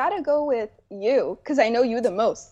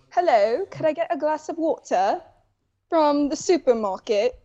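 put-on polite, formal English accent. Guest taking her turn at an English accent, formal. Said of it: "can we hear yours?".

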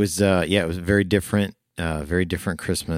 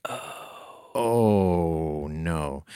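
stunned dismay; much slower than usual. Retold or imitated. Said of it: "And I was like".

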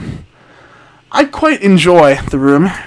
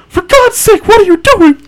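very high hammed-up husband cry; over-the-top panicked husband; 3 dB louder than usual. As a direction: exclaim in an alarmed put-on high voice. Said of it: "he cried".

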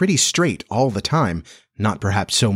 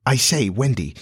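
plain reading voice

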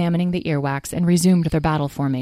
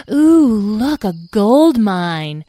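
high, loud "ooh" sliding into slow awe; delighted fairy; 4 semitones higher, 7 dB louder, much slower than usual. Acted. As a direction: gasp over sudden treasure.

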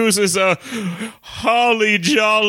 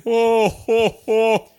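jolly ho ho ho. Acted. Santa laugh imitation.

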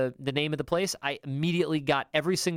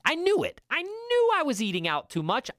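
much higher, rising in disbelief; high incredulous I knew it; exasperated realisation; 11 semitones higher than usual. Retold or imitated. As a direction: voice jumps up in self-reproach. Retelling his own realisation.

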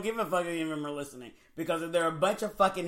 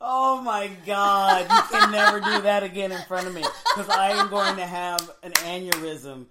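helpless laughter through the words; laughing hard; 7 dB louder than usual. Real, not acted. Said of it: "from laughing so hard".

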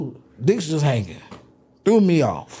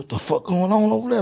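alarmed question; 7 semitones higher than usual. Retold or imitated. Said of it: "I said".

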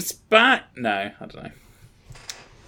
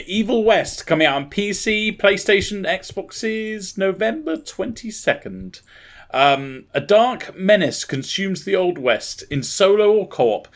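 emphatic promo read-out; announcer read; 3 semitones higher than usual. Acted. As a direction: sell the blurb line by line like an announcer. Reads out the game blurb.

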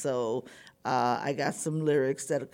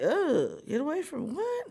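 mock standoffish recoil, pitched up; 10 semitones higher than usual. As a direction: recoil with a higher-pitched ugh. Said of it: "it was very standoffish".

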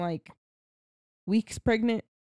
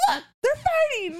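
put-on excited kid shout; much faster than usual.

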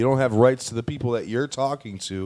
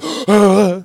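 sharp gasp; 6 semitones higher, 7 dB louder than usual. Real, not acted. Sudden gasp, then "Sorry.

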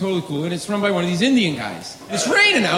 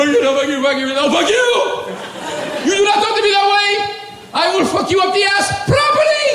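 street fight yelling; 11 semitones higher than usual. Retold or imitated. Vendor and comic yelling in the street, furious shouting. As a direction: scream it in a brawl on the street. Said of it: "He's coming toward me".

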